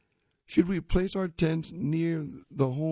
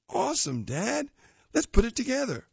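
put-on higher kid voice, eager and bright; 11 semitones higher than usual. Tiger answering his dad, high eager kid voice. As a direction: play an excited young boy.

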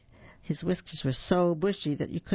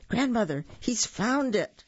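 alarmed cry; 7 semitones higher than usual. A narrator's reading. Maggie alarmed at discovery.

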